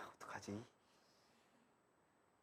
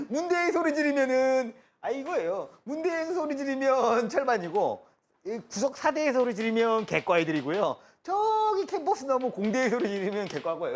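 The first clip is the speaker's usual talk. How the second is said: excited; 8 semitones higher, 7 dB louder than usual. Real, not acted. Excited, laughing explanation of the joke.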